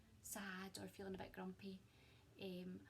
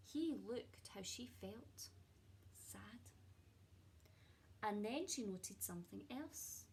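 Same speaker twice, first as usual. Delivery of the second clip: expressive storybook reading with hushed moments; gentle picture-book narration; reading to children; 3 semitones higher than usual. A narrator's reading. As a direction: read it like a cosy bedtime story to a class. Teacher reads picture book aloud.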